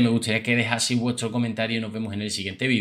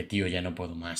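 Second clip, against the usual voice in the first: quiet fed-up quote; 3 semitones lower, 10 dB quieter than usual. Retold or imitated. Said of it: "Para que veáis un poco la reacción".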